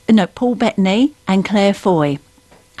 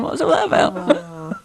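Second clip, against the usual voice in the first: laughing; 4 semitones lower than usual. Real, not acted. Baffled reaction, laughing.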